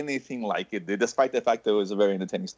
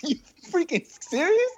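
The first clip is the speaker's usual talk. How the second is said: high, laughing, can't believe it; laughing disbelief. Real, not acted. To his co-hosts, disbelieving laugh. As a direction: ask it while cracking up. Friend shaking car for gas.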